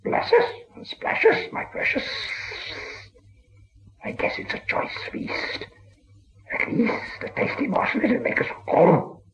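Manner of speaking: high, breathy hiss, voiceless and creeping; hissing Gollum whisper. A narrator's reading. Gollum whispering to himself as a stranger appears, hissing. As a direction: hiss it like a starving cave creature. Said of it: "whispered and hissed".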